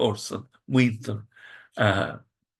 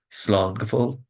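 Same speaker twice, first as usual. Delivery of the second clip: normal talking voice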